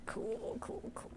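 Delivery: whispered, deadpan acceptance; very soft, voiceless resignation; resigned whisper. Real, not acted. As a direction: whisper it to yourself in dismay. Pet has just spilled the drink.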